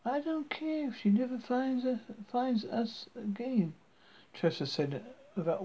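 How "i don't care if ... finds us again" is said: haughty princess voice. Acted. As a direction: look down your nose like a princess. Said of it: "what Mark thought of as a haughty princess voice".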